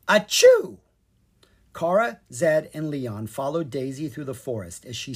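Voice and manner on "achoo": big high sneeze; high, loud mock sneeze. A narrator's reading. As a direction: sneeze it out fully. Chapter title sneeze.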